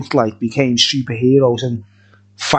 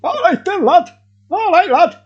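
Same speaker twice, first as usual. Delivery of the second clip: much higher, put-on northern lad voice; chirpy high greeting in a borrowed voice; Mickey Tomlinson. Greeting the viewers in the Mickey Tomlinson impression, high and put-on. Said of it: "Mickey Tomlinson impersonation".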